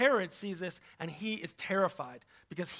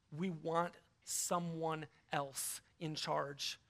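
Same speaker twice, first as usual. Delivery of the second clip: slow, soft, lower yearning voice; soft longing plea; 4 semitones lower, 8 dB quieter, much slower than usual. Retold or imitated. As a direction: voice the people's tired wish, slowly.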